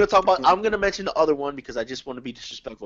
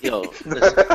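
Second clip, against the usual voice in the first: high loud laugh breaking the words; can't keep a straight face; 12 semitones higher, 3 dB louder than usual. Real, not acted. Host laughing at co-hosts, high squealing laugh. Trying to talk through laughter.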